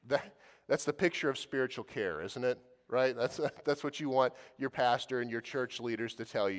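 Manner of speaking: ordinary talk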